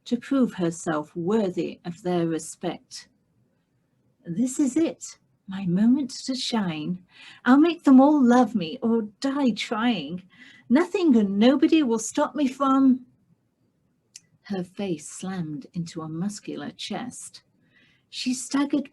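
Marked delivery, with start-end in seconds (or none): 4.2-13.1 put-on young lady's eager vow, a bit higher; eager heroine. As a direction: Constance steeling herself, rising with resolve. Heroine's hopeful inner vow.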